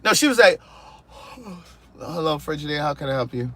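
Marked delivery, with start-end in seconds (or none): 1.8-3.5 softer, drowsy mock greeting, as if woken from a nap; sleepy and annoyed. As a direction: play the rep who can't be bothered, barely awake. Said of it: "like I was disturbing a nap".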